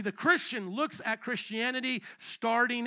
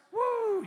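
high-pitched "woo" of celebration; short cheering whoop for the crowd; excited whoop; 13 semitones higher, 3 dB louder than usual. Real, not acted. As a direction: let out a high, happy whoop. Cheering the congregation's response.

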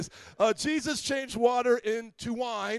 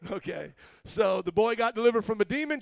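ordinary talk

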